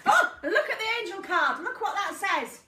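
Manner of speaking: high, loud burst of excitement; excited discovery. Real, not acted. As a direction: exclaim it loudly, pitched right up. Startled by the card drawn.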